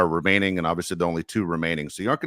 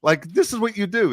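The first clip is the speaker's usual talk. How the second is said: mock outrage; 12 semitones higher than usual. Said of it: "everyone's like".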